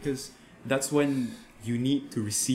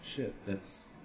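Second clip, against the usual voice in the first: whispered; 11 dB quieter than usual. Retold or imitated. Sudden private realization.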